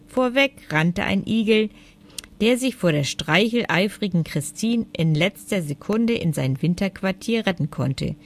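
ordinary delivery

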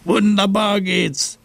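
high, slow shout of joy; gleeful shout. Acted. Seppel shouting from the ice, high and slow. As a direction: belt it out while gliding on the ice.

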